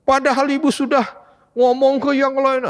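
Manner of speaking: a mother's high-pitched dismay at the firing; stressed mother. Retold or imitated. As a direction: play the panicked mom scolding her son. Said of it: "itu pasti stres dia".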